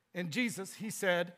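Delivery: usual speaking voice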